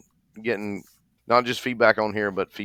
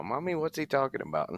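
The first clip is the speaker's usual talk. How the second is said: mock small child asking, pitched higher and softer; lightly done child's voice, higher pitch; imitating a kid; 6 semitones higher, 6 dB quieter, faster than usual. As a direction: playfully voice a curious little kid. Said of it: "I can just hear a kid now".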